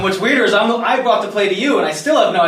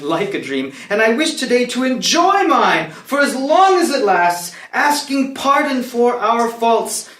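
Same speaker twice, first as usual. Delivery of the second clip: theatrical verse delivery, swelling and falling in pitch; stately, deliberate stage oration; dramatic closing monologue; 4 semitones higher than usual. Acted. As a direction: deliver the play's final speech to the court. Closing speech of play performed.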